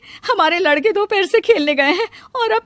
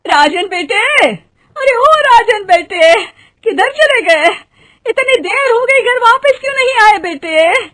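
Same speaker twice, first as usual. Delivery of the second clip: worried calling out; 12 semitones higher, 10 dB louder than usual. Acted. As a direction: call across a field like an anxious mother. Mother calling out for missing son. (ऊँची आवाज़ में चिंतित पुकार)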